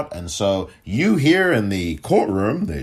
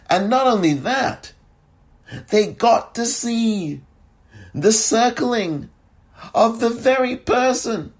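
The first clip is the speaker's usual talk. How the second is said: building excitement; excited; 10 semitones higher than usual. Real, not acted. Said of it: "I'm so excited".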